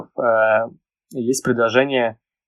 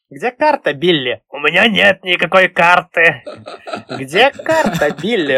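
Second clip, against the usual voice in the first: lightly voiced gruff pirate, raised pitch; Billy Bones impression; 4 semitones higher, 5 dB louder than usual. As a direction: bark the question like a menacing pirate. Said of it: "Вот это вот, типа".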